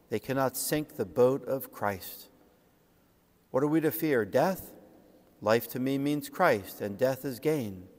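ordinary talk

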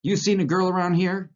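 loud, high-pitched angry question; angry demand. A narrator's reading. Curly bursting in looking for a girl, angry. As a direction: demand an answer, voice jumping up in anger. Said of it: "he demanded angrily".

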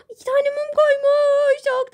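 gasp, then say it high and slow; high, slow, wide-eyed delight. Real, not acted. Friend gasps at the candles, delighted and high.

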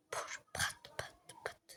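breathy little pat sounds for the blows; whispered hitting noises. Acted. Narrator whispering the blows of a fight, hushed noises. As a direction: whisper the hits softly.

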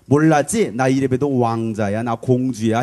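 boastful. Shown as a demonstration. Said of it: "옆에 사람한테 이렇게 가르쳐 주세요".

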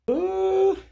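high nervous squeal of "ooh". Real, not acted. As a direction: squeak it out, jittery. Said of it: "I'm nervous".

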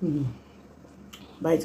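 speaking with mouth partly full; muffled between bites; chewing food. Real, not acted. Seen 0: Bites food at -5, lips pressed chewing early; talking by 1.8.

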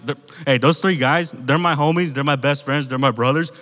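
normal talking voice

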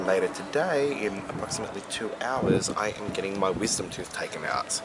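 ordinary talk, nothing marked

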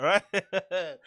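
ordinary delivery

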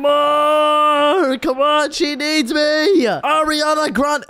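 high, loud, slow pleading yell; desperate shout. Acted. Desperately pushing blanket over sun.

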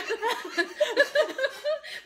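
high giggling laugh. Real, not acted. Laughing before eating chili.

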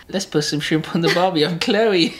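wife imitated in an exaggerated Australian accent, breaking into a laugh; bad Australian impression of Chloe. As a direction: lay on a clumsy Australian accent, mocking his wife. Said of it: "I can't do it, babe".

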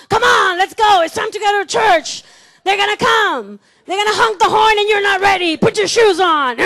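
loud, fast nagging shout; heated hurry-up. Acted. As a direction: yell at the family to get moving. Parent hurrying family to church.

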